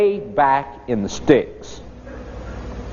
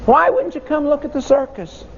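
put-on higher, quicker children's question; puzzled kids asking; 9 semitones higher than usual. Kids asking the teacher why he stayed seated, higher and puzzled. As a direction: ask it like bewildered kids. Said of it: "and we said to him".

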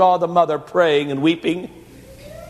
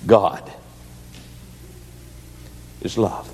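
quiet answer; 10 semitones lower, 7 dB quieter, slower than usual. A narrator's reading.

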